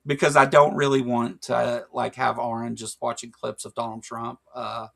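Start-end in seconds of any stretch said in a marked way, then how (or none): none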